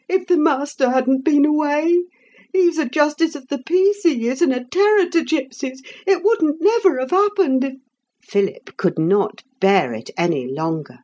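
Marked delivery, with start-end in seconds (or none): 0.1-7.7 put-on high, agitated old cook voice. A narrator's reading. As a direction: play the upset cook, pitched up.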